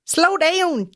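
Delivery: high-pitched attempt at a Scottish accent; hammed-up high Scottish accent; bad Scottish accent. Shown as a demonstration. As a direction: put on a broad, badly faked Scottish cry. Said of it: "get a slow down in Scottish".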